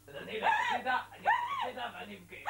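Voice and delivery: high, loud fake sobbing whine; hammed-up tearful wail; mock crying whine. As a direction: whimper like a sulking child about to cry. Mock whining, threatening to go cry.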